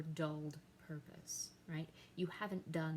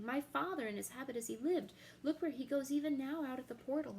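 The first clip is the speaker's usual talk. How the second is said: much higher and louder, pointing wildly; frantic shout; 6 semitones higher, 5 dB louder, faster than usual. Acted. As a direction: cry out in desperate excitement. Hamlet sees ghost leave.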